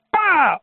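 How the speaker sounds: high, loud "pa" burst; imitated explosion bang. Survivor recreating the second blast, sharp loud bang. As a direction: fire off a sudden vocal bang.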